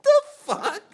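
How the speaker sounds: squeaky laughing what the fuck; laughing disbelief. Retold or imitated. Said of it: "and you're like".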